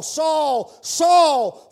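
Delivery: loud, high calling of a name. Acted. God calling down to Saul, loud and high. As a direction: call him from far off. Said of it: "A double call came to Saul".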